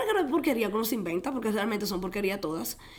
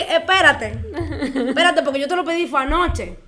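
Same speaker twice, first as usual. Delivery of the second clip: loud, high-pitched mock panic; hammed-up disbelief; startled protest; 5 semitones higher, 8 dB louder than usual. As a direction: protest loudly, half laughing, as if caught off guard. Acting out her protest to God.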